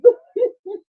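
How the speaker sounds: high cackle; high laugh. Real, not acted. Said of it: "I was cracking up".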